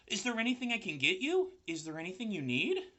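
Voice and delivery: put-on high, small baby spider voice; little spiders eager to help; tiny spider voice. A narrator's reading. Baby spiders speak to Wilbur.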